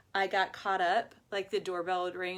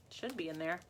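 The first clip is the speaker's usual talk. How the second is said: normal talking voice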